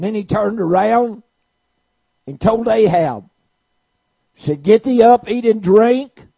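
a fiery preacher shouting the story; preaching at full force. Acted. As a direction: belt it out to the congregation.

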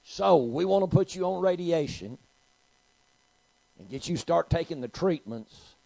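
loud, high, slow pronouncement; insistent doctor. Retold or imitated. Doctor telling patient she needs radiation, loud and insistent. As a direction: push the treatment on the patient, drawn out. Doctor urging treatment, acted in story.

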